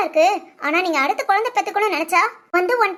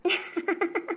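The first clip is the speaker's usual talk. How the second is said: soft, light giggling; amused giggle; 10 dB quieter than usual. Acted. Wife giggles at husband asking if this glee is needed, amused giggle. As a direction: giggle quietly in short bursts.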